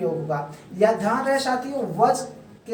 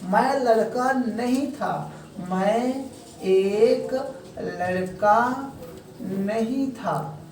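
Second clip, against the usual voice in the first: very slow, word by word, trailing softer; slow dictation, fading softer at the end; slow teaching demo; 3 dB quieter, much slower than usual. Shown as a demonstration. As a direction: lay out each word for a child. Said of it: "दूसरा negative भी होता है".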